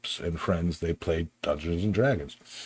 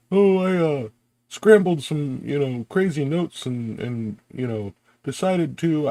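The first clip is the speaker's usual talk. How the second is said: imitating a friend; 8 semitones higher than usual. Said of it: "I can't do his accent".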